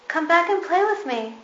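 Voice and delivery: high, loud call after them. A narrator's reading. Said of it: "called the little rabbit".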